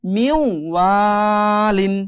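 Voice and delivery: মিউ ওয়ালিন with ওয়াও joined to মি, very slow; slow merged reading. Shown as a demonstration. Teacher recites the merged word slowly. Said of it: "মি এর সাথে ওয়াওটা মিলাবো".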